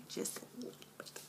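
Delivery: quick soft whisper; whispered aside. Real, not acted. Vlogger confiding to viewers about saving money, quick whisper. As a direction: confide it under your breath.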